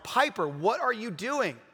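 exasperated parent, stretching the words, not shouting. Retold or imitated. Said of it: "now I'm raising my voice".

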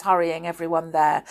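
plain reading voice

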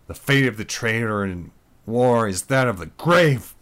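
loud, menacing declaration; exclaimed threat. Acted. Mike proclaiming the traitor's fate before killing Lisa, loud. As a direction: proclaim her doom. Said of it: "Mike exclaimed".

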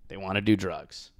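ordinary talk, nothing marked

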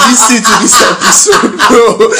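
high, loud, laughing begging; laughing plea. Real, not acted. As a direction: beg the listeners through laughter. Laughing pleading with listeners.

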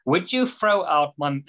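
ordinary delivery

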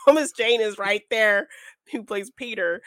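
ordinary delivery

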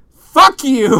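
loud cheerful sign-off shout; hearty shout. Acted. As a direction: bellow it out as a farewell. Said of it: "end the podcast with a hearty".

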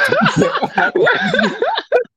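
loud, very high-pitched laughing fit; burst of laughter. Real, not acted. Everyone reacting to the failed impression, loud helpless laughter.

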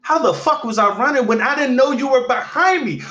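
high, loud comic indignation; hammed-up outrage tipping into laughter; retold indignant outburst. Acting out his indignant retort.

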